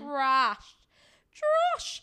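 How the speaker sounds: indignant squeal of trash; outraged cry. Real, not acted. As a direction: spit out "trash" in disgust. Exclaiming men are trash.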